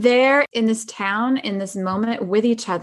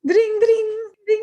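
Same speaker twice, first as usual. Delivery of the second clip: high sing-song ding ding; imitated phone ring; 12 semitones higher than usual. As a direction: chime it like her pretend phone ring. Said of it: "I love how she pronounces the ring of the phone".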